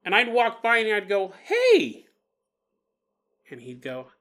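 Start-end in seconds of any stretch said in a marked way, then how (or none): 1.4-2.1 put-on high, loud little-girl greeting; child's cheery hey. Little girl calling hello to the old man on the porch, high and loud. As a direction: call out brightly like a small girl.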